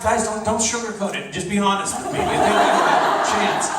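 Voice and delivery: high-pitched mock plea; earnest pleading. Friend asking pals not to sugarcoat it, mock pleading. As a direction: imitate a friend begging for the truth. Retold plea for honesty about shirt.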